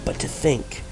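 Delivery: low, soft, menacing villain. Acted. Villain Saturos mourning the trap, low and grim. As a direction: brood darkly over the survivors' fate. Villains speak in cutscene again.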